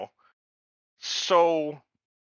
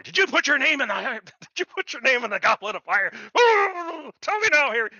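high, loud mock-Dumbledore outburst; overacted frantic demand; 11 semitones higher, 4 dB louder than usual. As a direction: ham up a panicked interrogation of Harry. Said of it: "So that overacting thing that went down".

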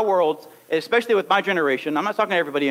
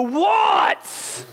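exasperated dad shout; 11 semitones higher than usual. Acted. Father losing patience with a nagging kid, shouting. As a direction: yell back like a worn-out father. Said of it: "You finally go".

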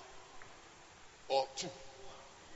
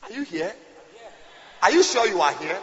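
normal talking voice

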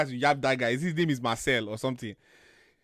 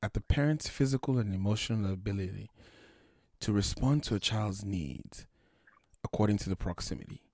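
soft, low audiobook narrator voice; calm reading voice; 5 semitones lower, 5 dB quieter than usual. Retold or imitated. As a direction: read it softly like calm audiobook. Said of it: "She'll be like this".

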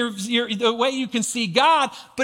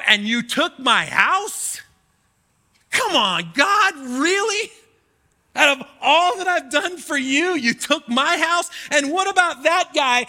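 indignant complaint; 4 semitones higher than usual. Retold or imitated. Preacher retelling his cry to God over the lost house, indignant. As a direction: demand it of God, really offended.

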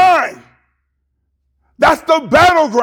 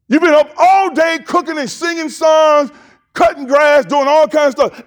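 preacher shout; 4 semitones higher than usual. Real, not acted. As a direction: call it out to the whole church.

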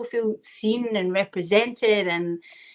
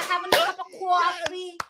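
exaggerated Long Island New York accent with a laugh in it; overdone Long Island accent; 6 semitones higher than usual. Shown as a demonstration. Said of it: "and they talk like that".

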